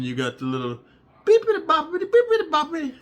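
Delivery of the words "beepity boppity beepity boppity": high, loud falsetto nonsense syllables; silly sound effect. Acted. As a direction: squeak out a cartoonish beeping. Comic imitation of quick motion.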